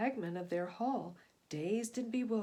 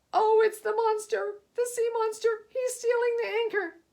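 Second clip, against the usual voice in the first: much higher, louder panicked shout; terrified cries; 11 semitones higher, 9 dB louder than usual. Acted. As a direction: scream it as the ship lurches. Passengers cry in terror.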